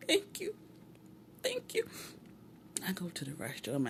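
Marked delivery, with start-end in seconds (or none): 0.1-1.9 very high, choked-back thank you; holding back tears. Retold or imitated. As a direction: squeak out thanks while mock-fighting tears. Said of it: "try to suck it up".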